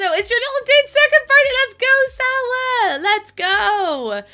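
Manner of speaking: high, bright birthday cheer; excited. Real, not acted. Streamer cheering a viewer's kid's birthday, excited. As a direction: hype up the birthday shout-out.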